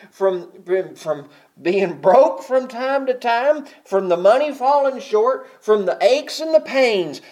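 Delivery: loud, high, driving sermon delivery; full-force preaching. Acted. Preacher thundering to the congregation about Christ's suffering, loud and high.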